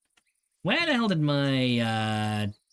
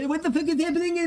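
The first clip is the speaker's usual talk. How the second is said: high, disbelieving cry; baffled outburst; 12 semitones higher than usual. Retold or imitated. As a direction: squeal it out in total confusion. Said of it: "I was just like".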